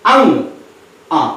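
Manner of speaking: ordinary talk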